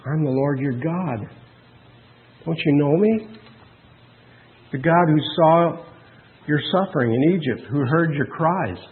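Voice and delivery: soft, gentle voice of a loving God; quiet, intimate reassurance; tender. Acted. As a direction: say it softly, as a tender plea. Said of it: "The tender voice of God says".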